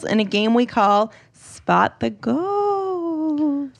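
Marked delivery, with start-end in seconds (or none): 2.0-3.7 high spooky ghost voice. Acted. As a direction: moan it high like a cartoon ghost. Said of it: "That was my ghost impression".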